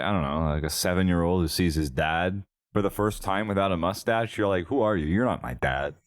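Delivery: ordinary talk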